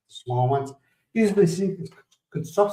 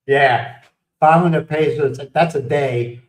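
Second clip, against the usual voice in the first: ordinary delivery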